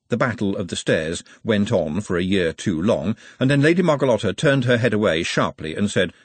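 ordinary delivery, nothing marked

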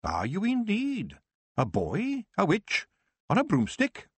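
high, slow, disbelieving questions; old witch doubting a boy on a broomstick; old witch doubting a boy witch, pitched high; incredulous old witch. Acted.

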